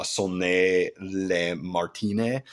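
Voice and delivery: "Sonnet le Martine" slowly, syllable by syllable, French-style; careful French attempt. Real, not acted. Host warns of butchering the title, "Sonnet le Martine" drawn out carefully in attempted French. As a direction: sound out "Sonnet le Martine" haltingly as so-NAY le mar-tee-NAY. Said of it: "I'm gonna butcher this title".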